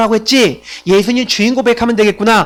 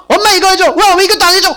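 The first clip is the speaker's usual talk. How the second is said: much higher mock-tantrum voice; imitated demanding child yelling; 10 semitones higher, 4 dB louder than usual. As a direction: play a bossy kid yelling demands at mom. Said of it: "그러면서 막 소리 지른다니까요". (엄마에게 떼쓰며 소리치는 아이 흉내)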